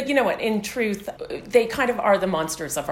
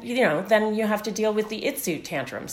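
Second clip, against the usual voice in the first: ordinary talk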